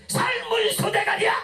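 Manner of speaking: 아주 높은 새된 고함으로 욕하기; 과장되게 내지르는 북한발 욕설 흉내; 김여정. Acted. 북한 김여정의 욕설 흉내. (버럭 내지르는 비난)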